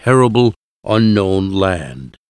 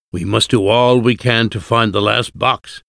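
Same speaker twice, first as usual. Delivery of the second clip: ordinary delivery, nothing marked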